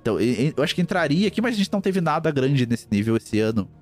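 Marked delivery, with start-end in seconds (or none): none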